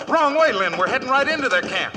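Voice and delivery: high loud warning; alarmed warning. Acted. Rider warning Lynn they head into the camp. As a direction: call out the danger.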